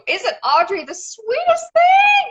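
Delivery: high, loud gushing over how sweet she is; gushing squeal. Retold or imitated. Retelling to the host how she raved about Audrey, high loud gushing. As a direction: squeal it out like an adoring fan. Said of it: "and I told you".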